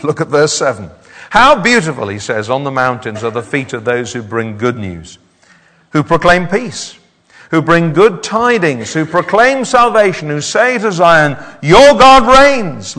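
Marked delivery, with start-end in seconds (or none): 1.3-11.4 a lightly raised, ringing reading of the verses; impassioned scripture reading. Acted. Preacher reads Isaiah to the congregation, fervent. 11.6-13.0 high, loud and slow, each word ringing out; triumphant proclamation. Acted. Watchmen call out to Zion, slow triumphant cry.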